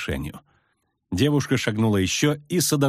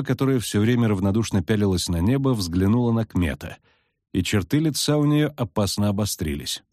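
usual speaking voice

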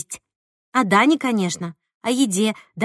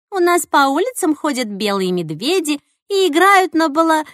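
higher, louder mock tall tale; playful teasing; 7 semitones higher, 4 dB louder than usual. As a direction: spin it as a cheeky joke. Marina's teasing quote.

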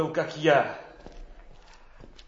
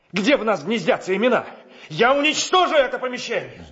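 loud, high angry exchange; angry shouting; 5 semitones higher, 5 dB louder, faster than usual. Acted. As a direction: shout in fury. Romeo rages, friar restrains him.

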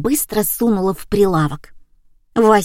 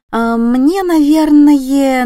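drawn-out order; 6 semitones higher, 4 dB louder, much slower than usual. A narrator's reading. Customer begins to order, hesitating.

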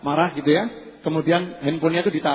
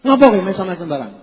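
mock rude reply; 2 semitones higher, 4 dB louder, faster than usual.

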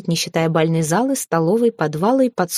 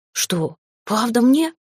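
high, slow disbelieving question; astonished house spirit; 5 semitones higher, much slower than usual. A narrator's reading. House spirit is offered a name, high slow disbelief. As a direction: draw it out, rising in wonder. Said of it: "Домовой вытаращился".

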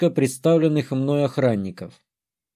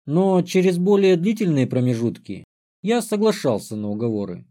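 plain reading voice

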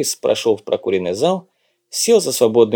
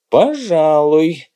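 slow, drawled agreement with raised pitch; drawn-out reply; 5 semitones higher, 3 dB louder, much slower than usual. A narrator's reading. Guest answering the old maître about going upstairs to play, drawling. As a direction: stretch the word out lazily long, slightly higher. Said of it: "протянул Дымша".

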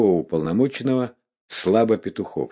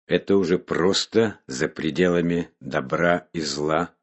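ordinary delivery, nothing marked

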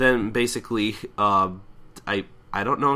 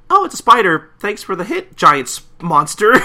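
much higher and louder, cheery; Pinkie Pie voice; 9 semitones higher, 10 dB louder than usual. Retold or imitated. Pinkie Pie cheerfully thanking the spider, high and loud. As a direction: greet the monster brightly way up high. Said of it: "And Pinkie Pie's just like".

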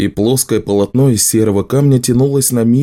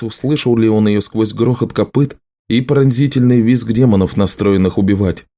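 usual speaking voice

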